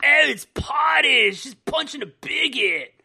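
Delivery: slow, high, loud California surfer drawl; hammed-up Californian surfer accent; California surfer dude. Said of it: "now I sound like a California surfer dude".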